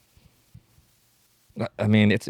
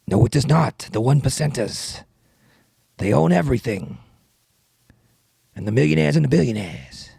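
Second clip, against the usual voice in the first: Bernie Sanders. Acted. Politician refusing to stop, emphatic impression. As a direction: hammer each phrase like a stump speech. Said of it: "rapping Bernie Sanders".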